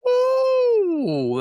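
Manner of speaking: one long high owl whoop; one big single warning hoot; single owl note. Shown as a demonstration. Owl telling another it's in his zone, one high whoop. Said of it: "that one big single note".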